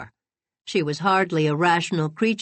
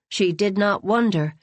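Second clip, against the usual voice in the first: plain reading voice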